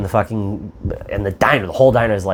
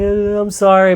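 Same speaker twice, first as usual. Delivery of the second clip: higher mock weepy apology; mock crying; 8 semitones higher than usual. Mocking the caught couple crying, higher mock weepy sorry. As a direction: parody the crying victims. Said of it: "they're all crying".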